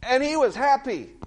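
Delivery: mildly acted excited report, high-pitched; high, lifted retelling of excitement. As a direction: imitate a thrilled husband passing on news. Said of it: "Dad was all excited".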